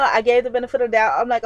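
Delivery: ordinary delivery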